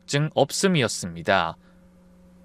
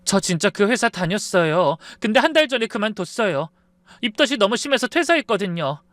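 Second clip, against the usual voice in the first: higher-pitched, hurt pleading; tearful pleading; 7 semitones higher than usual. A narrator's reading. Girlfriend explaining her job to boyfriend's mother, tearfully earnest. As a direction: explain yourself, a touch tearful. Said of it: "목소리가 울먹이더라고요".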